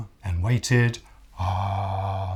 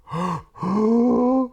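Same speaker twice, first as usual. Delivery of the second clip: strained gasp; 6 dB louder than usual. Acted. Crocodile trying to sing.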